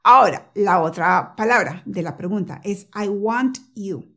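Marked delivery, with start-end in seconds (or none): 2.9-4.0 'I want you' slowly, each word separate, T popped. Shown as a demonstration. Teacher introducing the next phrase, slow word by word. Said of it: "lentamente, pronunciando cada palabra".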